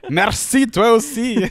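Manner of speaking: high, loud mock thanks; mock polite. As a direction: chirp the thanks back, overly polite. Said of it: "sont comme".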